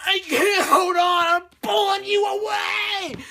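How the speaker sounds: high, loud, stretched-out desperate yell; hammed-up movie-climax shout; screaming. He tells her he's pulling her away, screaming. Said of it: "so they're screaming".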